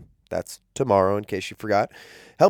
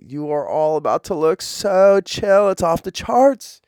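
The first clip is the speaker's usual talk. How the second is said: surfer dude voice; 7 semitones higher, 4 dB louder, slower than usual. Acted. Surfer slang jersey hype.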